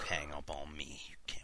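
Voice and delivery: breathy whispered aside. Real, not acted. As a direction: whisper it so the line can't hear. Hushed aside before the call connects.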